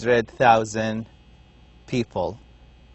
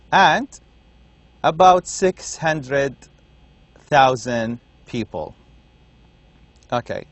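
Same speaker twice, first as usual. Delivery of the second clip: normal talking voice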